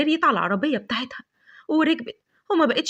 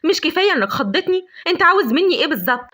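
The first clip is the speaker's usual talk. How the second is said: louder, sharp, higher irritated demand; 3 semitones higher, 4 dB louder than usual. Acted. Lavender snapping at the big stranger, loud irritated demand. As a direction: snap at the stranger who scared her. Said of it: "قالت له بعصبية".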